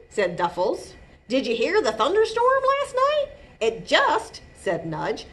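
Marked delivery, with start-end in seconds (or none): 1.3-4.4 high, eager storytelling voice; excited otter. A narrator's reading. Otters telling Jitterbug about the storm, excited. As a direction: gush the news as a chatty otter.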